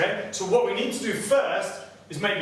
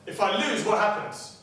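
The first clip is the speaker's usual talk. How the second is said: ordinary talk, nothing marked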